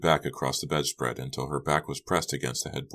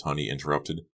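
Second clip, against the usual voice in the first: usual speaking voice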